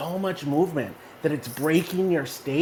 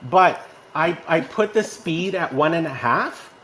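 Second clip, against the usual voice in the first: ordinary talk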